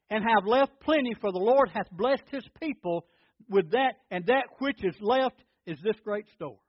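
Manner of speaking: loud, high, driving sermon voice; full-force preaching. Acted. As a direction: preach it out to the congregation. Preaching the Hezekiah story.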